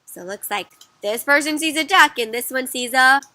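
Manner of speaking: ordinary delivery, nothing marked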